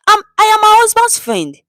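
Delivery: very high, loud flustered reply; confused man answering the thugs. A narrator's reading.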